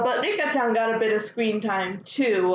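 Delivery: ordinary talk, nothing marked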